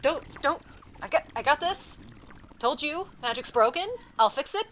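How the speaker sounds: loud, high, frantic shouting; Kara begs the party to freeze while she fixes it; high panicked yelling, words tumbling out. Acted. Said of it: "she's like".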